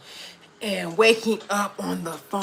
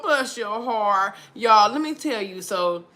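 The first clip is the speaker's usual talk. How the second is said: usual speaking voice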